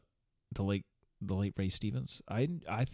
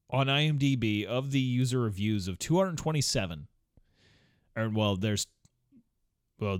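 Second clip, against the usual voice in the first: ordinary delivery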